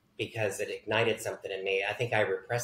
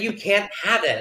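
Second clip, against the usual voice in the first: mock-scolding mother, higher and louder; mother's firm refusal; 10 semitones higher, 7 dB louder than usual. Mother refusing her child a doll, mock scolding. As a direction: refuse loudly, imitating an exasperated mother.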